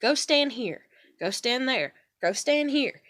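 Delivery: wife directing husband where to stand; giving directions. Retold or imitated. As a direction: direct him like a coach. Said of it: "I was like".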